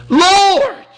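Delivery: high, loud cry of "Lord". Retold or imitated. Desperate father calling out to Jesus, loud and high. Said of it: "cried out and said with tears".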